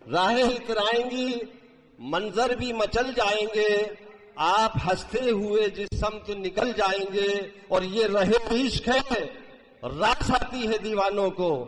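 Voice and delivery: declaimed Urdu verse; stately nazm recitation. Acted. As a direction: recite the couplet with grand feeling. Mushaira poetry recitation. (شاہانہ انداز میں نظم خوانی)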